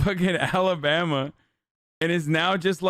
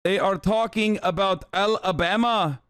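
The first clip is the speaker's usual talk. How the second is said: put-on Middle Eastern accent, raised pitch; mock Taliban accent; 4 semitones higher than usual. Taliban recruiter commenting on Alabama girls, mock Middle Eastern accent. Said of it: "The Taliban shows this compilation".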